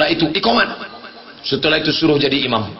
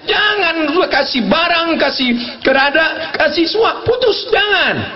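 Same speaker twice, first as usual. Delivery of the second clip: urgent shout; 12 semitones higher than usual. Real, not acted. Preacher shouting warnings at parents.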